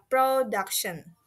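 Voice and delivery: slow "production", DUC stressed; stress on middle syllable. Shown as a demonstration. Teacher repeats for learners, slow "production" stressed on DUC. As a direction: draw out "production", weight on DUC. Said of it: "it should sound that".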